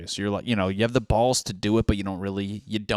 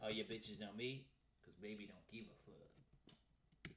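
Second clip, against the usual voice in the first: much softer, half-mumbled under the breath; muttered lyrics; 26 dB quieter than usual. Retold or imitated. Recalling [name]'s lyric to himself, soft mutter. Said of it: "reciting lyrics under breath".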